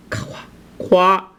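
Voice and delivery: kwa said as one syllable like the start of queen; Cantonese kwa. Shown as a demonstration. Teacher models kwa for learners. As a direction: kwa with a rounded kw glide. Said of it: "like in queen".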